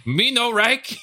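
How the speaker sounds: high, put-on broken English; squeaky mock accent voice. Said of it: "that first lady goes like".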